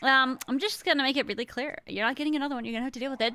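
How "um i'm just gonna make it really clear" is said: normal talking voice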